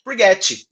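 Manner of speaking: "Por get" said as "por get-chi" with an extra i at the end; teacher shows how coworkers say it among themselves: "get" with an added i; Brazilian "get-chi". Retold or imitated. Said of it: "a gente fala assim".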